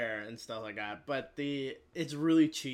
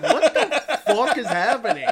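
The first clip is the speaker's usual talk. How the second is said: laughing disbelief; 8 semitones higher, 12 dB louder than usual. Retold or imitated. His own retold reaction, laughing.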